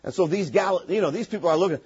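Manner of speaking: ordinary delivery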